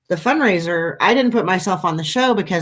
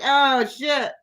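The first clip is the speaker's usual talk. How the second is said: laughing mock dismay; 7 semitones higher than usual. Tourists caught out, retold.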